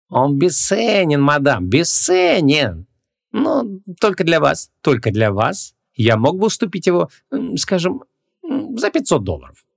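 high, slow, theatrical salesman; wheedling shopkeeper. A narrator's reading. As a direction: hesitate grandly before naming a price. Shopkeeper haggling theatrically over amulet.

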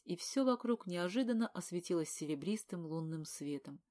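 ordinary delivery

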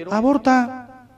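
drawn-out, high, mocking "Aborta"; sarcastic slow push to abort; mocking advice. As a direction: sell it like cheap advice. Mocking the easy answer again.

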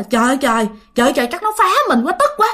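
high, frustrated outburst; fed up and complaining, pitch climbing fast; exasperated anger. Acted. Father grumbles that spirits are messing with him, exasperated anger. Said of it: "Ba mới tức quá".

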